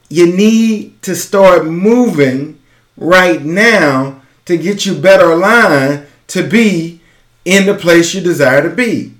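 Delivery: ordinary delivery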